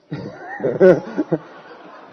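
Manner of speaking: bursting into a high, loud laugh; real laughter. Real, not acted. Telling how the skeptic got exposed, real laughter.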